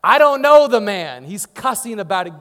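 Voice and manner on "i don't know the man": a loud, angry outburst; angry denial. Retold or imitated. Peter snapping his denial at the accusers, loud and angry. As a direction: bark the denial at the crowd. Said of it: "he's cussing under his breath".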